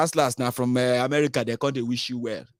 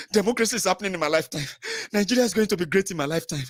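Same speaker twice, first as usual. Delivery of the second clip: fast, raised excited gushing; rushed mock gushing; 6 semitones higher, faster than usual. Mocking 2015 voters, fast giddy gushing. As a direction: blurt it out giddy.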